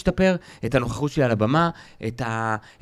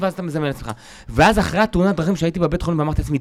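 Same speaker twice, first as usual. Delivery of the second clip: ordinary talk, nothing marked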